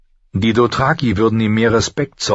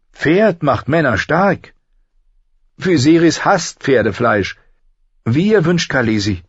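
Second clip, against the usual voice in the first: higher-pitched character voice; a handmaid answering her mistress; voiced handmaid; 7 semitones higher, 3 dB louder than usual. A narrator's reading.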